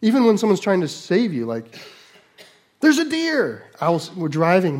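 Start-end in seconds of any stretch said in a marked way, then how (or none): 2.8-3.6 high-pitched mock warning cry; imitating a panicked passenger's shout; wife's startled shout. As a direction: yelp it as a sudden alarm. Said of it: "But she'll shout".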